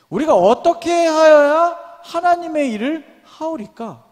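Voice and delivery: declaimed scripture question. Acted. Preacher reads the crowd's question to Jesus aloud, slowly declaimed. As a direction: read the question out high and deliberately.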